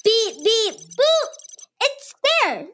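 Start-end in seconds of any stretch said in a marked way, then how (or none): 0.0-1.3 high playful beep boop; robot beeps. Pretend. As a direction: chirp it like a little computer. Child storing memory like machine.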